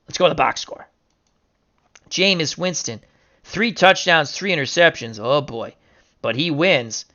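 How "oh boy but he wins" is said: ordinary delivery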